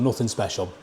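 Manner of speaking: a muted afterthought; quiet repeat. Real, not acted. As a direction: echo "Nothing special" to yourself under your breath. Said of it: "That's probably what I'll say about this one".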